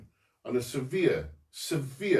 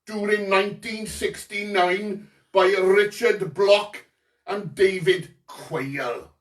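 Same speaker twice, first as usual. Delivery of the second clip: put-on forceful Porthcawl accent; 3 semitones higher, 4 dB louder than usual. Quizmaster asking about company founders, in a Porthcawl accent. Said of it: "this is my Porthcawl accent".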